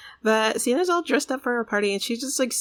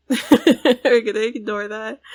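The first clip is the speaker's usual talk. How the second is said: laughing; 9 dB louder, slower than usual. Real, not acted. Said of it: "repeated, laughing".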